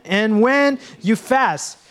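slow, weighted, stress on when; slow emphatic reading. Shown as a demonstration. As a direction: read it slowly with the congregation, hitting when. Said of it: "Say it with again".

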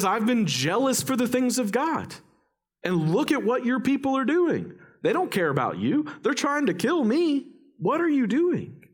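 high-pitched exasperated complaint; mad at God. Acted. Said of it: "He's mad at God".

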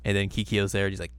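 ordinary talk

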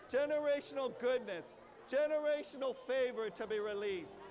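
loud, fervent praying to God; fervent prayer. Acted. Pastor models prayer for gathered families, fervent and loud.

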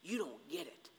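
low, hushed rebuke; God responding. Acted. God answering Job's accusation, low and hushed. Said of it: "And God responds".

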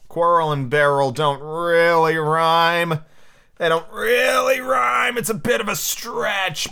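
slow, drawn-out protest rising high; exaggerated gripe, building in pitch; mock complaint. Real, not acted. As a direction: stretch each word into a strained high complaint. Mock complaint about the bad rhyme.

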